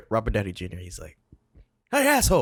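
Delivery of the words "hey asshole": mock movie tough guy. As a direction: shout it like the movie character. Quoting Downey's character.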